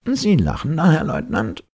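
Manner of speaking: high-pitched, indignant professor; professor challenging. A narrator's reading. Professor turning on the smiling lieutenant, high challenge.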